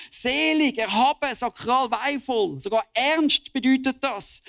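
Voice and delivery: fiery preaching. Real, not acted. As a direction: preach it out with full conviction to the congregation. Preacher at full force. (kraftvolle Predigt)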